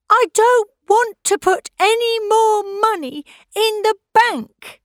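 high, loud protesting shout; girl yelling in protest; angry shout. A narrator's reading. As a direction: shout it in defiance, high and fast. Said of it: "shouted Pauline".

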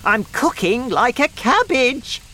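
high, loud complaining grandma; shouting. A narrator's reading. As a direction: yell the complaint, pitched high. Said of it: "she shouted".

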